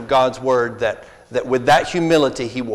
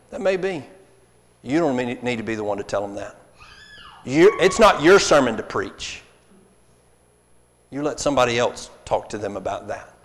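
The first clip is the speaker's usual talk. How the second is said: ordinary talk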